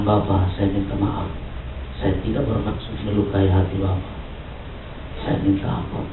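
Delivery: very soft, low plea; hushed, humble confession, retold without sobbing; retold tearful apology. Fallen mentee kneeling to beg forgiveness, hushed apology. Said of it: "Dia berlutut di kaki saya dan dia menangis".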